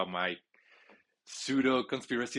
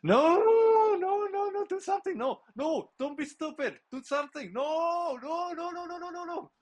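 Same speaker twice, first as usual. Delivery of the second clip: frantic high-pitched begging, much higher; panicked high pleading; 5 dB louder than usual. Real, not acted. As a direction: beg the screen in rising panic. Panicking at the game's last seconds.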